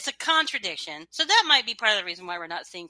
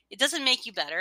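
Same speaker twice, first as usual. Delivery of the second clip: usual speaking voice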